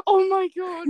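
high, loud shocked cry; shocked exclaim. Real, not acted. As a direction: blurt it out in shock.

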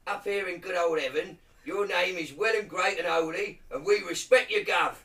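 loud, high, hammed-up Cockney; Cockney accent. Reading the Lord's Prayer in Cockney to mates, loud Cockney accent. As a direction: belt it out as a comic Cockney. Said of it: "hear the Lord's Prayer in Cockney".

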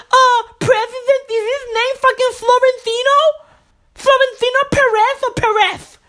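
very high-pitched lisping character, hammed-up pissed; Lispy Lefty. Lispy Lefty griping about Spanish names, high lisping whine. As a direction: squeal it with a heavy lisp, comically aggrieved. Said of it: "Lispy Lefty, is always so fucking pissed".

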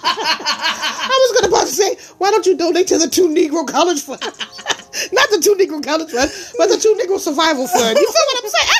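laughing through the words; cracking up at own joke. Real, not acted.

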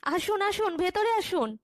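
calling in. A narrator's reading. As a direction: usher a guest in with a lifted pitch. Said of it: "বলতে বলতে ডেকেছি".

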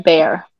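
"bear" said for the drink beer; "bear" with an open eh vowel; beer mispronounced. Real, not acted. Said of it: "I say the word of the animal".

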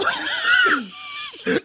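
high shrieking laugh. Real, not acted. Friends joking about washing face.

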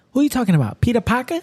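put-on high, questioning wife with a light New York accent. Wife on Instagram asking, light New York accent. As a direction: ask it like a puzzled New Yorker. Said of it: "She's like".

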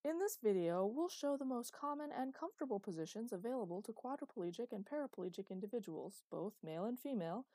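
an eighties training-tape narrator, bright and overeager; hammed-up announcer reading an old rehab video; cheesy video narrator. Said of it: "continued the narrator".

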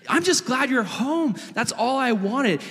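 high-pitched, heartfelt acted joy; overjoyed father. Dad tells son he is welcome, overjoyed. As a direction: play a dad bursting with relief.

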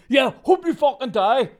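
high-pitched mock shout of abuse; aggressive goodbye. Worker acting out quitting before returning his keys, exaggerated aggression. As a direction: hurl it as a hammed-up furious parting shot. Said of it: "Except aggressive".

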